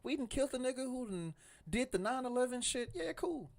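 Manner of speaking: high, excited imitation of cheering people; hyped celebration. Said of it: "Everybody was up in arms, like".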